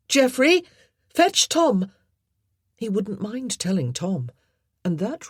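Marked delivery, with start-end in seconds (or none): 0.1-1.9 loud, urgent call through a door. A narrator's reading. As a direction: call him back urgently. Jean calling through door.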